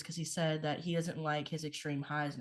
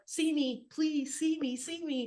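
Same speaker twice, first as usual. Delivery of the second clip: a much higher, begging plea from a neglected feeling; pleading emotion; 10 semitones higher, slower than usual. Acted. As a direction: voice the ignored emotion calling out to be seen. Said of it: "it's gonna scream at you".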